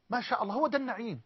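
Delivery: usual speaking voice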